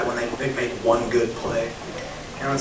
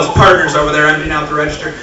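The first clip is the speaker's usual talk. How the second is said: normal talking voice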